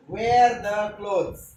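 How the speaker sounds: very slow, each word drawn out; slow teaching drill. Shown as a demonstration. Teacher saying it slowly for fifth graders. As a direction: spell it out slowly for the kids. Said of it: "काय?".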